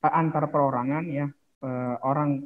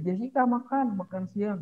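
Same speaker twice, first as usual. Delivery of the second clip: high calling-out voice of a parent; parent calling to eat; 6 semitones higher than usual. Acted. Parent calls Jessica to lunch, high mock calling voice. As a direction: call your kid to lunch from the next room.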